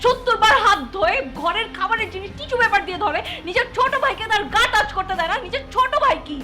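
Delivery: indignant rant building to a loud, high peak; heated tirade. Acted. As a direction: pile up the accusations, voice climbing. Acted quarrel, tirade listing grievances.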